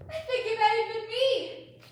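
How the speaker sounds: loud, high hammed-up shriek; scream. Narrator shrieking as the one who screamed. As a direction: let out a big theatrical scream. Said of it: "somebody screamed".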